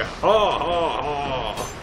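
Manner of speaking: drawn-out gloating ha ha ha; slow hammed-up evil laugh; slow mock villain laugh. Gloating after kill.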